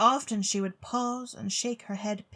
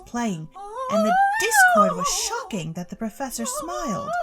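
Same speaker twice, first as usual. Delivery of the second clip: very high falsetto warble, louder; shrill soprano; 8 dB louder than usual. A narrator's reading. Narrator reading as Mister Bostwick sings in a high soprano. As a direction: read it in a hammed-up soprano squeal. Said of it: "began to sing in a shrill, tremolo soprano".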